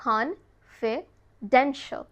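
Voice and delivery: Confidential stretched very slowly, ending "shul"; slow teacher demo; slow drawn-out model. Shown as a demonstration. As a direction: draw out Confidential slowly for learners. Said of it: "slow model".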